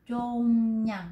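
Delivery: "chung nhân" đọc rất chậm, âm r thành N; nhả chậm từng tiếng "chung nhân"; đọc mẫu biến âm. Shown as a demonstration. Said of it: "cô sẽ đọc là chung nhân". (phát âm mẫu chậm "chung nhân")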